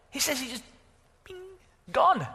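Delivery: very high, incredulous retelling; amazed vanishing. Acted. Preacher shows Philip vanishing a second time, astonished. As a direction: lift it right up, marvelling.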